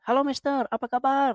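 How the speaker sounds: high-pitched Indonesian street greeting, imitated; playfully imitated high greeting to a passing foreigner; mock friendly greeting. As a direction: call out a cheery hello, imitating a local. Acting out friendly locals' greeting.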